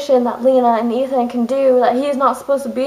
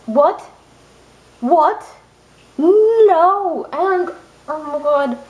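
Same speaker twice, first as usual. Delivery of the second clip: outraged disbelief; 4 semitones higher, much slower than usual. Real, not acted. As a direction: protest at the ruined ending. Reacting to ending.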